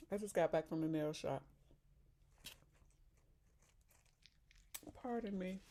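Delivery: talking while chewing; words round a bite of food; mouth full. Real, not acted. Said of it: "You probably hear me chewing anyway".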